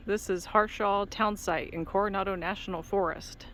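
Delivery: ordinary talk, nothing marked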